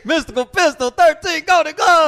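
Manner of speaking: high, loud announcer shout-outs; hype announcer. Acted. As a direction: call names like a ring announcer.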